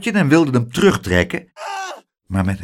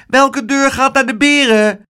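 put-on high, eager child voice; a little boy asking which door; small boy; 14 semitones higher, 5 dB louder than usual. A narrator's reading. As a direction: pipe it up like an impatient little boy. Child voice of Spijtebijt.

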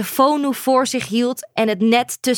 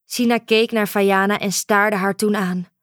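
plain reading voice

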